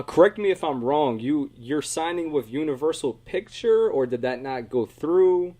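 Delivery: ordinary delivery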